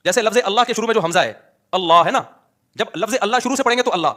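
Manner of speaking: ordinary talk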